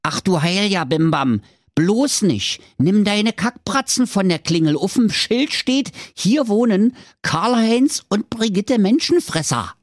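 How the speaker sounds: put-on high, panicky kids' voices; alarmed children. A narrator's reading. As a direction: squeal at him to stop.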